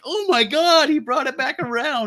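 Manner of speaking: excited disbelief. Retold or imitated. Retelling his own shock at a comic twist, high excited exclamation. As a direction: gasp it out like the comic just blew your mind. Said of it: "I was like".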